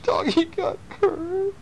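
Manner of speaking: high, slow mock-crying little boy. Dad reading a storybook boy's line, mock crying. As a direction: whimper it like a sad small boy. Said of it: "Little boy's going".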